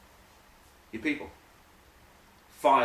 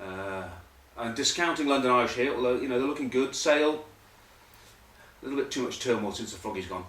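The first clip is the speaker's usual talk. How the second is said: ordinary delivery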